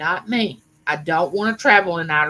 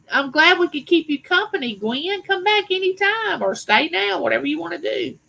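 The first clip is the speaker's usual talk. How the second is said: excited welcome; 9 semitones higher than usual. Real, not acted. Cheerfully greeting a live viewer.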